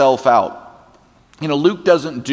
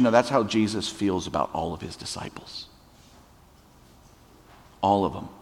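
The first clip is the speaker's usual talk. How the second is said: ordinary delivery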